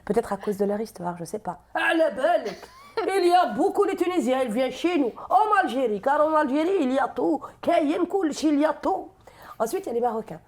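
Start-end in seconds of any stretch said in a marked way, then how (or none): 1.7-9.1 put-on high, loud Algerian accent; virulent Algerian accent. Comedian shows how Algerians talk, virulent Algerian accent. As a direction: boast forcefully in a raised Algerian accent. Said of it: "ils sont un petit peu plus virulents".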